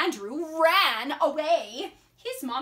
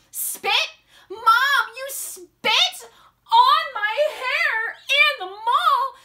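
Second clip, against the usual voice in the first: put-on high, loud, appalled child; outraged yell; 10 semitones higher, 8 dB louder than usual. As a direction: protest loudly to mom in disbelief. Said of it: "yelled Andrew".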